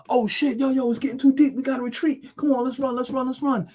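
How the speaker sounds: mock panic, urging everyone to flee; panicked. Panicked retreat imitation.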